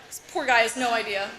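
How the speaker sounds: ordinary delivery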